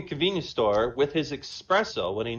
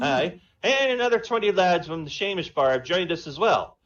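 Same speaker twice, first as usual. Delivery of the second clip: higher, louder Irish brogue; Irish accent; 5 semitones higher, 5 dB louder than usual. Acted. Paddy the Irishman phoning the president, Irish accent. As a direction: play a cocky Irish pub man. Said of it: "Irish and Jamaican".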